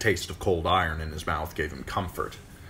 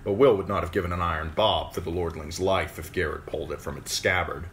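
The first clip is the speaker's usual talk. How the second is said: usual speaking voice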